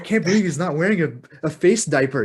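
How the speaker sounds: put-on incredulous whine; mock disbelief. Mimicking shocked mask complainers.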